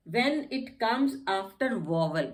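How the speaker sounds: very slow, deliberate answer; slow rule. Shown as a demonstration. Teacher answering slowly about silent gh. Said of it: "GH का कहाँ silent होता है".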